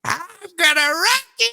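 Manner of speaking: put-on high, slow, quavering old woman; old lady voice. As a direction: croon it like a frisky granny. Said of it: "What do you think of my old lady voice?".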